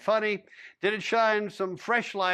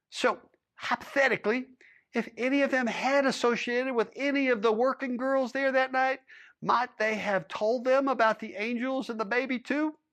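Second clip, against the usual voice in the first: a higher, pressing official's voice; lightly voiced higher lawman interrogating a cowboy; stern lawman; 6 semitones higher than usual. A narrator's reading. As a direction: lean in like a lawman demanding answers.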